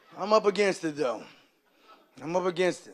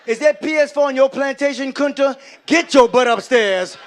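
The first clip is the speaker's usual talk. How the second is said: loud, slowing to emphatic orders; scolding dad; 3 semitones higher, 8 dB louder, slower than usual. Acted. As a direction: order a whining kid upstairs, hitting every word. Dad scolding son over dishes.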